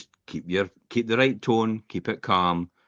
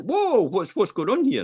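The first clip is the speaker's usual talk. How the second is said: much higher startled whoa; taken aback; 12 semitones higher than usual. Retold or imitated. Husband startled when his wife goes straight for him, high surprise. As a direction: recoil in surprise at the accusation. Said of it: "and he's like".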